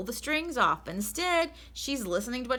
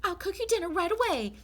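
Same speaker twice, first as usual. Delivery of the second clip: bossy lady of the house, much higher and brisk; Mrs Rogers; 12 semitones higher, 4 dB louder than usual. Acted. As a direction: read out the chore list as the fussy employer.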